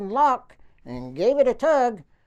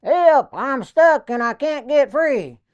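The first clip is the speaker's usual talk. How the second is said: slow, loud call from high up; yelling for help; 5 semitones higher, 7 dB louder, slower than usual. Retold or imitated. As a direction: shout it down from the tower. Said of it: "he yelled".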